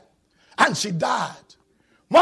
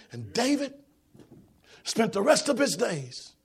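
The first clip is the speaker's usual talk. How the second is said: normal talking voice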